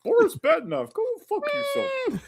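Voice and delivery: high, loud cartoon moose squawk; Bullwinkle voice. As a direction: belt it like a dopey cartoon moose. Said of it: "That would be brilliant".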